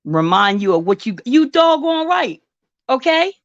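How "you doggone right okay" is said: sassy retort. Real, not acted.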